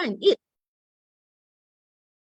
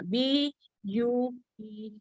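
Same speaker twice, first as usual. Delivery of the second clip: slow spelled letters; 6 dB quieter, slower than usual. Shown as a demonstration. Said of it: "That's hammering".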